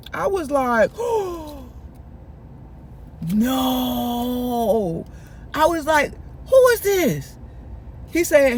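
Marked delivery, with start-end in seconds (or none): 0.9-1.6 sharp acted gasp; shocked gasp. Storyteller seeing Sean at the door, shocked gasp. 3.3-5.1 long, held-out wail of disbelief; dismayed no. Retold or imitated. Storyteller opens the door to Sean, long dismayed no. Retold shock at seeing Sean. 6.5-7.4 high-pitched mock disbelief; startled question. Storyteller asking Chris about Sean, high and startled. Retold shock at the door.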